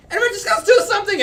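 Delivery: high, loud, fast shout; a kid yelling orders in a panic; panicked yell. Acted. Frightened child screams at the others, high, loud and fast.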